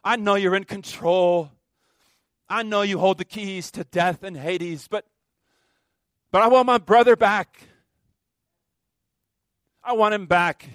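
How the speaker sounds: loud, rising plea that peaks on the brother; Mary pleading. Acted. Preacher voicing Mary to Jesus, loud desperate plea. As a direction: beg with full heart for your brother back. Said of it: "Mary saying".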